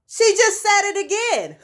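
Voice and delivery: high-pitched retold squeal of news; excited disbelief. Woman telling her friend the result was confirmed, incredulous. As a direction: act out reporting it in astonishment.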